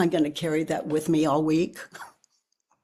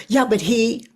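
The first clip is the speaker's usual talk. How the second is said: mind's protest; 5 semitones higher, 6 dB louder than usual. Retold or imitated. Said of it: "My mind would go".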